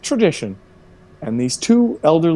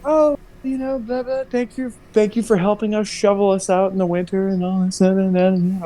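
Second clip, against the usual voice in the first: high mock elderly gushing; 9 semitones higher than usual. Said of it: "they're like".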